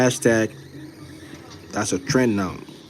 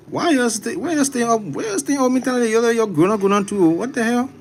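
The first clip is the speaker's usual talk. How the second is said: much higher, louder nagging voice; mock scolding elder; 12 semitones higher, 4 dB louder than usual. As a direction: scold them like a fussy auntie. Scolding late-night viewers in character.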